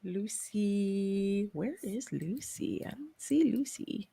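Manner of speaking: whispered and slow, searching. Real, not acted. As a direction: breathe it out under your breath while looking. Searching the list quietly.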